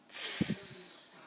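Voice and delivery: a sharp tss hiss like a match going out. Shown as a demonstration. Teaching the tz sound, hissing like a snuffed match. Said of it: "like putting out a match".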